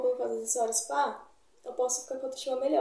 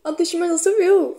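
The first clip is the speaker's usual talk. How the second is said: excited boast; 5 semitones higher, 7 dB louder than usual. Real, not acted. Excited boast about self-esteem.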